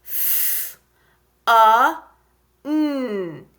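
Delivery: "F, a, n" as separate sounds fff, uh, nnn; breathy F, loud uh for "a", hummed "n"; sounding out. Shown as a demonstration. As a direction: tap out each sound of "F, a, n" with pauses. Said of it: "Let's tap it together".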